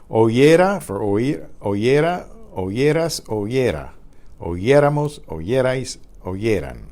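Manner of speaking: oyera said clearly as o-ye-ra; teacher modeling the forms of oír; model Spanish forms. Shown as a demonstration. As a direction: drill oyéramos with stress on ye. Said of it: "Just do it slowly".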